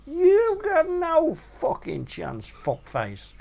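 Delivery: Godzilla's voice. Retold or imitated. Big woman hearing money mentioned, hammed-up high voice. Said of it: "Godzilla interrupted by saying".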